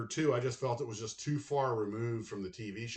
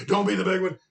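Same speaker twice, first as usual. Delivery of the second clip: louder plea; 8 semitones higher, 10 dB louder than usual. Retold or imitated. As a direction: repeat it louder and higher, pleading. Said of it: "and I was like".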